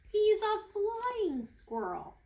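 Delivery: high, slow and loud, full of wonder; triumphant reveal. A narrator's reading. Narrator reveals to the kids he can fly, triumphant. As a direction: unveil it like a marvel.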